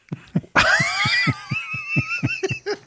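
high shrieking laugh. Real, not acted. Laughing at own scare story.

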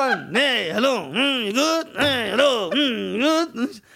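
loud rolling hums and hellos; mumbled, swooping greetings on repeat. Retold or imitated. Comic imitates the rapper greeting people, loud mock singsong. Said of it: "kommt er so und sagt so".

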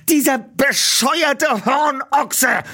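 high, slow, seething curse; furious outburst. Acted. Peter cursing the dog owner after the crash, slow high fury. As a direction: spit out the insult in anger. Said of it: "stieß er wutend hervor".

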